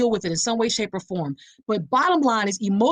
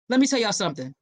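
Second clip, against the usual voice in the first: normal talking voice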